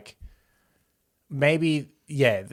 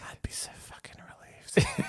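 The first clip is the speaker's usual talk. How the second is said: whispered menace cracking into a squeal; creepy mother's whisper; 6 dB quieter than usual. Acted. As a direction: whisper that breaks high on the last word. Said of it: "she creeps over and sits".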